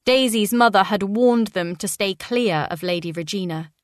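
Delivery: plain reading voice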